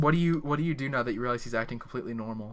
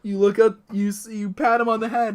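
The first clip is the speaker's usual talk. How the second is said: usual speaking voice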